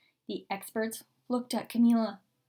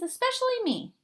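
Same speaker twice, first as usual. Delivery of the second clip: high pouting protest; 13 semitones higher, faster than usual. Acted. Girl faking disgust.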